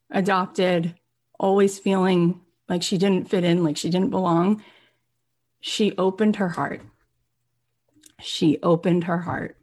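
ordinary talk, nothing marked

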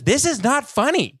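high, slow imitation of a fed-up wife; exasperated wife. Wife rejecting husband's comedy pick, mock exasperated.

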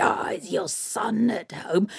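slow sly whisper; troll hag. Acted. Troll hag at the door, sly whisper. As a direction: ask slyly, hiding your purpose.

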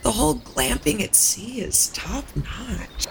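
whispered aside; hushed teasing whisper. Acted. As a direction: breathe it out as a secret. Aside to Rosalind at party.

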